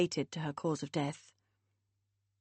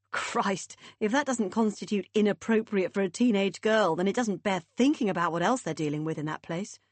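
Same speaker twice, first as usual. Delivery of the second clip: ordinary delivery, nothing marked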